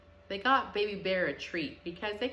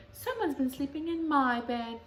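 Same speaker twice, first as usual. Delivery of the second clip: higher, louder mother bear; a brisk higher mama bear; raised mama bear voice; 6 semitones higher, 5 dB louder than usual. A narrator's reading.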